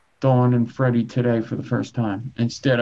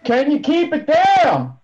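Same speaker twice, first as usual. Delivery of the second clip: loud; 13 semitones higher, 4 dB louder, slower than usual. Real, not acted. Someone yelling from off stream.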